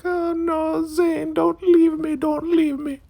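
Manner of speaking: extremely high wailing falsetto; hammed-up desperate plea; mock begging. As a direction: beg like a frightened child clinging on. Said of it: "now she's begging".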